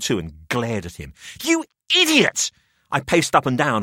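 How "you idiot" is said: high, loud angry cry; furious outburst. A narrator's reading. Narrator raging at Jamie after the head sinks, furious cry. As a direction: rage at him.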